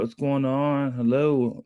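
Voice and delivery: ordinary talk, nothing marked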